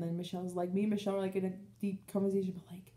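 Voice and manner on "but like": whisper. Real, not acted.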